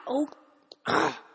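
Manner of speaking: usual speaking voice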